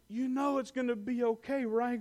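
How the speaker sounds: a father comforting his scared son; tender reassurance. Retold or imitated. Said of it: "I says".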